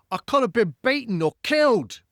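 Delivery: high, loud outraged spy; quoting Michael Caine. Acted. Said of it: "he goes".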